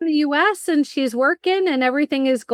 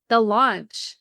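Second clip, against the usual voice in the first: ordinary delivery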